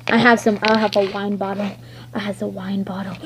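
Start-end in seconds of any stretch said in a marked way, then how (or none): 2.1-3.2 secret whispered repeat. Pretend. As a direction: hush it like a secret. Toy repeats line.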